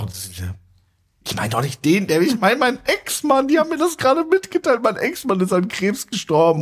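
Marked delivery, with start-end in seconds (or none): 1.3-6.6 upset explaining. Retold or imitated. Woman explains it was ex-husband.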